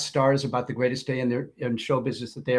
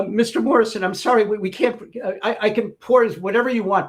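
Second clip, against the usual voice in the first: higher, louder stammering apology; nervous apology; 7 semitones higher, 4 dB louder than usual. Retold or imitated. Waitress apologizing to [name], higher and stammering. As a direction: stammer anxiously to a star. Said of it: "She goes back to his".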